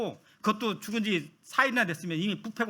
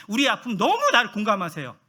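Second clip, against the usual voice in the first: ordinary talk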